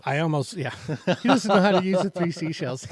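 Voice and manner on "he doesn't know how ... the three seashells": high, laughing movie quote. Retold or imitated. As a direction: quote it through giggles. Both laughing over film line.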